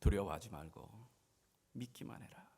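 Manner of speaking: low, hushed voice of Jesus; gentle reassurance. Acted. 예수의 말, 낮고 잔잔한 첫 번째. (낮고 잔잔하게 다독이듯)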